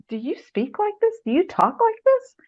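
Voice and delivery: mentor's challenge. Retold or imitated. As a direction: lift the voice, asking it over and over. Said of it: "about every other paragraph".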